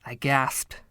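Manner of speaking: ordinary delivery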